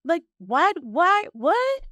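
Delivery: high, exasperated disbelief; can't believe he's asking you; incredulous outrage. Real, not acted. Outraged at a man asking money.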